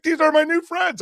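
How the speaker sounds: excited driver. Retold or imitated. Driver introducing passengers to his daughter, excited. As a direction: crow it in a high voice. Driver showing friends on FaceTime.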